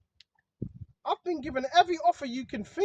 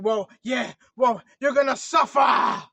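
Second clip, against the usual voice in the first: loud, drawn-out mock threat; Satan threatening; 2 semitones lower, 7 dB louder, slower than usual. Satan lying to those who choose not to, mock shouted threat. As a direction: stretch the last word into a shout. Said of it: "Satan is gonna lie to you".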